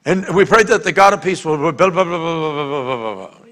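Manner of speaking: hurried, mindless rattle trailing off; rushed prayer. Shown as a demonstration. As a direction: race through the prayer into mumbled blahs. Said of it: "Because instead of going".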